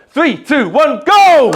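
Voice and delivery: high, very loud count building to a shout; all-out rallying countdown yell. Real, not acted. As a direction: whip the crowd up to a big shouted last word. Counts crowd down for level three.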